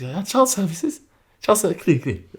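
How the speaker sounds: high mocking mum voice; menacing mum. Mum mocking her son's threat. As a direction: throw the threat back at him, daring. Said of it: "the most menacing reaction".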